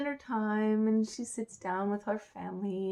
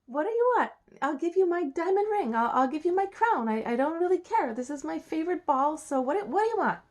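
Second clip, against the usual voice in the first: higher, louder princess bargaining; eager to settle the bargain, pitched up; impatient princess; 7 semitones higher, 5 dB louder than usual. Acted. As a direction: push the offers out, high and insistent. Princess offers frog anything.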